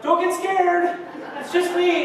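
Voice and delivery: high, loud call across the church. Retold or imitated. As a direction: call out to reassure him from afar. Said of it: "she even called out".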